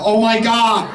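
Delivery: ordinary talk, nothing marked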